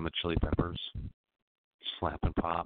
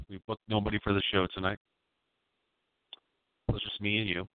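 lightly hyped late-night host read; radio host; 5 semitones higher than usual. Acted. Host opening his late-night call-in show, mock-hyped. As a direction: sell the show like a cheesy DJ.

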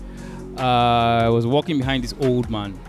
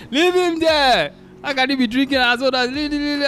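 high-pitched indignant friend; 9 semitones higher than usual. Retold or imitated. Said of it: "the first reaction I got from Selobi".

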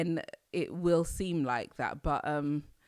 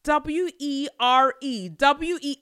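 letters said slow and loud; spelled out; 6 semitones higher, 7 dB louder, slower than usual. Shown as a demonstration. Spelling "were" for viewers, loud and slow. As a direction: spell each letter with emphasis. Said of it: "you put were".

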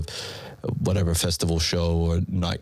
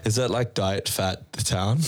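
ordinary delivery

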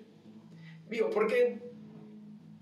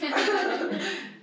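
a high amused laugh; 12 semitones higher, 4 dB louder than usual. Real, not acted. Laughs after own retort.

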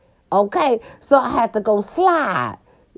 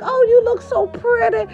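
mock compliment. Teasing sister's wig, mock compliment.

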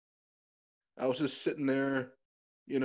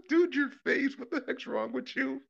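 high-pitched, laughing mockery; retold laughing; 12 semitones higher than usual. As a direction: re-enact cracking up at someone, voice pitched way up. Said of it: "busted out laughing at him".

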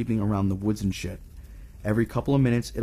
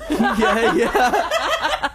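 breaking up with laughter; laughing; 6 dB louder than usual. Real, not acted. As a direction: laugh through the yeahs. Laughing at a co-host joke.

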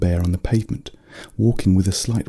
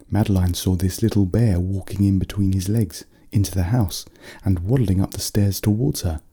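ordinary delivery, nothing marked

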